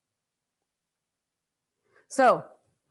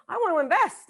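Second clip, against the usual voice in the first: higher, eager announcement; excited; 7 semitones higher than usual. Acted. Attendee telling a spouse about investing, excited. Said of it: "you're going back all excited".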